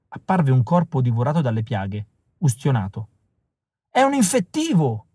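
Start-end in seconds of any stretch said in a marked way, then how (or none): 3.9-5.1 high alarmed cry; a lightly voiced car owner yelling in fear; alarmed shout. A narrator's reading. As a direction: shout the warning to the crowd. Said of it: "urlò il proprietario dell'auto".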